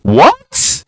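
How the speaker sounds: high, startled squeak of disbelief; incredulous what. Real, not acted. Reacting to the brother's dominance claim with a high, stunned squeak. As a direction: jump way up in pitch, stunned.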